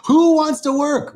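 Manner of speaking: a real laugh bubbling over. Real, not acted. Laughing at not wanting work.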